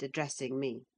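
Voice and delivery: usual speaking voice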